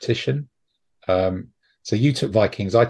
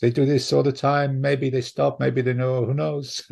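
imitated French shrug of a voice, higher-pitched; hammed-up French accent; 4 semitones higher than usual. Frenchman brushing off questions about the strike, mock French accent. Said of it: "they all speak like that".

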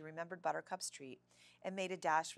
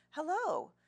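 high, cheery receptionist greeting; welcoming, pitched-up hello that settles into a friendly question; pleasant smile; 5 semitones higher, 4 dB louder than usual. A narrator's reading. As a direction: greet customers with an audible smile. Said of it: "she asked with a pleasant smile".

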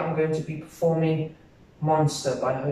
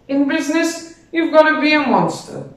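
much higher-pitched, forceful declaration; emphatic monster's boast; 10 semitones higher, 4 dB louder than usual. Acted. A student performing a monologue as a ruthless monster in business, high emphatic boast. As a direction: pitch it way up and proclaim it.

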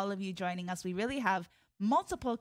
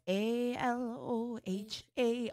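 spelled out; 2 semitones higher, slower than usual. Shown as a demonstration. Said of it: "first letter of each line".

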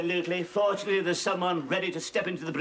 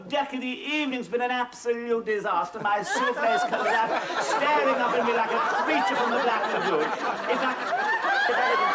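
mincing camp voice, higher; camp impression; 4 semitones higher, 3 dB louder than usual. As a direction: flounce through it, hammed up. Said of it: "you should have heard him the other night".

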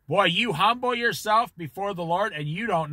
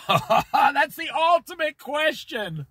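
laughing; 6 semitones higher than usual. Real, not acted. Preacher laughs at God's question.

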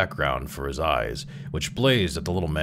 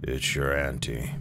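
lower, softer, cold sepulchral voice; cave-deep solemn; 4 semitones lower, 5 dB quieter than usual. A narrator's reading. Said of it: "a true voice from a cave, cold, solemn, and damp".

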